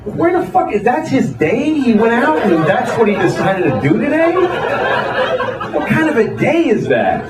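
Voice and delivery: baffled outrage, pitch jumping up; incredulous. Acted. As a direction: question it in drawn-out disbelief. Baffled rant at man with sign.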